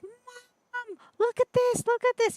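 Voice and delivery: put-on high, loud whiny child's call; insistent child calling. Child demanding mum's attention, calling high and loud. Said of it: "they get louder and louder and louder".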